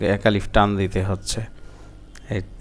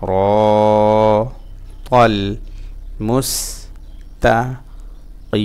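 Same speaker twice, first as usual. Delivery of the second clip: ইহ, দি, নাস and the rest chanted singly; broken-up syllables; much slower than usual. Shown as a demonstration. Teacher breaking up a Fatiha verse for learners, syllable by syllable. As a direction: pause after each syllable, holding র and ই long. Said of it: "ভাঙা ভাঙা উচ্চারণ".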